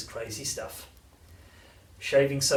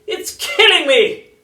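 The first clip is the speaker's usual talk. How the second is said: put-on slow, piercing old-woman shriek; frantic grandma protesting at full blast; outraged scream; 14 dB louder, slower than usual. A narrator's reading. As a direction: stretch each word in a high full-volume screech. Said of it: "she screamed".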